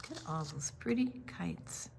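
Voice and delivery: soft unvoiced whisper. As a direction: hush it like sharing a secret. Hushed aside during story reading.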